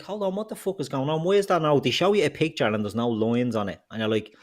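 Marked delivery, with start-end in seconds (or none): 0.0-1.8 exasperated disbelief. Retold or imitated. Retelling the same reaction, exasperated.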